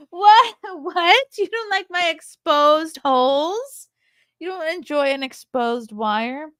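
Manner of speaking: high, loud, playfully offended; mock indignation. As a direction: protest in hammed-up disbelief. Teasing viewer about exposed outlet.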